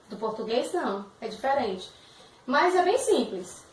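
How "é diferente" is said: ordinary talk, nothing marked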